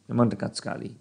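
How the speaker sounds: softly repeating to himself; quiet echo. Shown as a demonstration. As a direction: let it fall away to a murmur.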